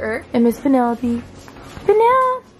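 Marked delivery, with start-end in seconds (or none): 1.9-2.5 calling out. Real, not acted. Calls the dog.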